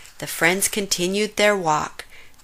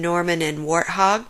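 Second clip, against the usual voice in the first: ordinary delivery, nothing marked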